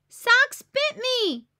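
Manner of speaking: slow, high, loud yell; child yelling after the puppy bit her; outraged yell. Acted. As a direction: shout it out, hurt and outraged. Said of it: "yells Bluey".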